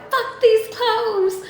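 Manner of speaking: high, slow mock disdain; dismissive dream quote. Emptying her suitcase in the dream, slow high scorn. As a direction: drag it out, tossing the clothes away.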